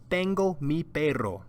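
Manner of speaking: Tengo mi perro said clearly, stress on tengo; Spanish model sentence. Shown as a demonstration. Teacher models Carlos's line for students to repeat. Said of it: "repeat after me".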